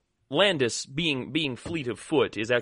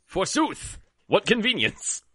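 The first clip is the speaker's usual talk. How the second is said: higher mock-old exclamation; hammy stage actor; 7 semitones higher than usual. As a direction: ham up an old-fashioned cry.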